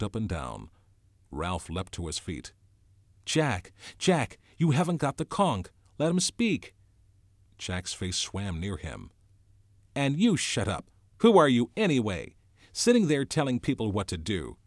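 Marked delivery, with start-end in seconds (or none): 3.2-6.7 high, raised voice of objection; urgent protest. A narrator's reading. Ralph leaps up to defend Piggy, urgent protest. 9.9-14.6 loud, higher, scornful attack; angry challenge. A narrator's reading. Jack turns on Ralph's leadership, angry challenge.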